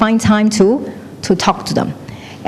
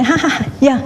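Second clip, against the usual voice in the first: higher, laughing "ha, ha" agreement; fake polite laugh; 5 semitones higher than usual. Daughter-in-law answering her in-laws, polite laugh. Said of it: "you say".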